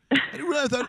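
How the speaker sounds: surprised. Real, not acted. Surprised it was her.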